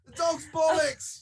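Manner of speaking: high, hammed-up British exclamation; put-on British accent. Playing a put-on Brit.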